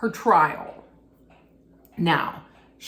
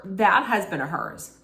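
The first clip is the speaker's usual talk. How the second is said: ordinary talk, nothing marked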